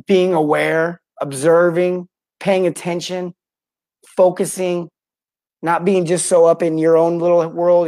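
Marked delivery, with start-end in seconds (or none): none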